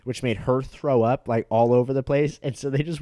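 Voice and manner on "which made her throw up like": normal talking voice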